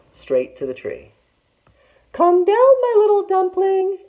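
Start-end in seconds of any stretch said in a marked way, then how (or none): 2.1-4.0 high, sugary put-on auntie voice; sweet fake auntie. A narrator's reading. Tiger to Big Sister, luring her in sweetly. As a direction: lure her in with false sweetness. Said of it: "Auntie Tiger called sweetly".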